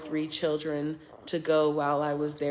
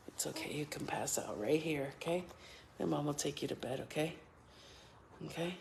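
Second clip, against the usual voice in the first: soft lulling; 13 dB quieter, faster than usual. Real, not acted. Lulling baby to sleep.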